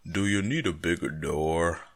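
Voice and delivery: mock movie-trailer voiceover; imitating a commercial's announcer; Hollywood announcer voice. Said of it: "some Hollywood voice comes in".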